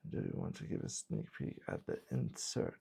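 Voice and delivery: whispered aside off mic; whispering. Real, not acted. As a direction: whisper the question quietly. Asks off-mic about sneak peek.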